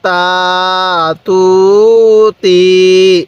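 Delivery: very slow, each vowel stretched long; drawn-out syllables. Shown as a demonstration. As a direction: stretch সা সু সি for a learner. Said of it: "তাহলে কিভাবে পড়ব?". (টেনে টেনে ধীরে উচ্চারণ)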